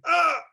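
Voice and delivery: sharp shouted ah; release scream. Retold or imitated. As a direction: scream out the tension. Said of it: "then they scream".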